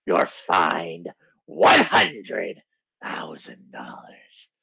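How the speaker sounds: slow, low, hammed-up boss growl; mock-menacing boss voice, drawn out; Vince McMahon. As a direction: pronounce a penalty slowly, sinking to a whisper. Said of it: "And Vince is like".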